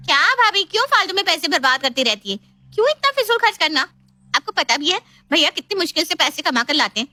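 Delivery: high, exasperated scolding; nagging sister-in-law. Acted. Sister-in-law scolding bhabhi over wasted money, nagging. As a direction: complain up high, lecturing as you go.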